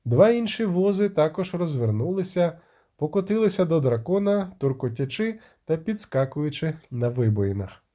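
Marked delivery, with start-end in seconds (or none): none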